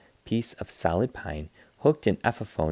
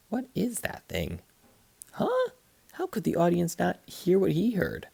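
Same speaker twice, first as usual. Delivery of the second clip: puzzled crowd; 10 semitones higher than usual. A narrator's reading.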